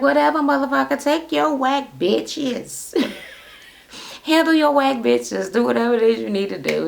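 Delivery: high, sassy mock send-off; dismissive comeback. Fired woman telling off her ex-boss, mock dismissive. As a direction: imitate her brushing him off in a high voice.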